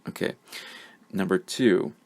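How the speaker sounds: ordinary delivery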